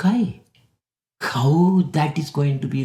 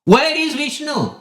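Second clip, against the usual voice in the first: sharp high demand; angry demand; 11 semitones higher than usual. Retold or imitated. King demands of Prahlad where Vishnu is, high and sharp. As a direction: snap the question up high.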